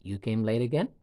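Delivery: "again" rising at the end into a question; rising intonation. Shown as a demonstration. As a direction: make it a question by lifting "again". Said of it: "Can you feel the rising intonation at the end of it?".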